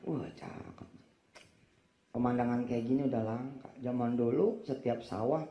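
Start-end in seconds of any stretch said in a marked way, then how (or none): 0.1-0.9 1 whispered admiration. Real, not acted.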